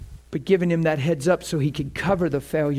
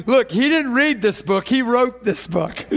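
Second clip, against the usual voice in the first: higher, through real laughter; laughing; 6 semitones higher than usual. Real, not acted. Preacher jokes that God wrote the book, laughing. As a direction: keep talking while cracking up. Preacher cracks up at own remark.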